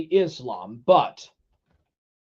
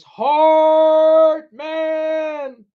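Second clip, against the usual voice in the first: high, loud call with long held vowels; drawn-out mock-heroic call; hammed-up holler; 9 semitones higher, 6 dB louder than usual. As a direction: stretch both words out at a high held pitch. Hammed-up reading of chat message.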